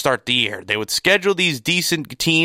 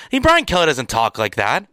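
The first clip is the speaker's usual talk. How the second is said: ordinary talk, nothing marked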